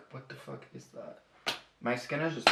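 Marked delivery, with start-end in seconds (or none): 0.1-1.2 very low, barely audible mutter; muttered aside. Real, not acted. Vlogger noticing something on their skin, muttered aside. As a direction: mutter it to yourself, much softer.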